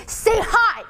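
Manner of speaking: high-pitched, forceful order; angry command. A narrator's reading. Girl ordering a silent classmate to greet, angry. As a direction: push her to speak.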